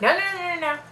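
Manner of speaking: quick high run of refusals; mock panicked protest. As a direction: rattle it out in a high, urgent string. Retold panicked refusal in car.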